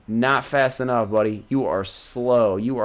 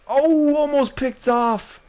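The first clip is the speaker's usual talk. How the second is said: surprised gasp; 13 semitones higher, 4 dB louder, slower than usual. Real, not acted. Near interception.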